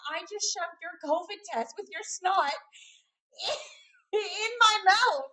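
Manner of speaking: high, drawn-out mock outrage; frantic. Husband explains the test mix-up to wife, mimicked as frantic. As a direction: imitate an appalled husband, slowing and rising to a loud finish. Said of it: "like, frantic".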